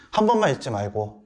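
normal talking voice

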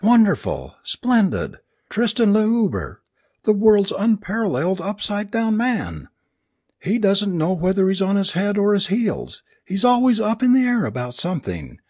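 high-pitched, anguished mock fanfare; bitter cry. A narrator's reading. Alice mocks her husband's stage plan, crying bitterly. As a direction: cry it out like a scornful showbill announcer. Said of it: "crying bitterly".

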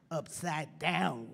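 ordinary delivery

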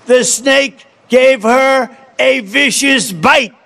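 measured storytelling read, slowing on the snake; a slow, dramatic poem read; poem recitation. Acted. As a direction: recite it like a fable to the crowd. Continues reading the poem.

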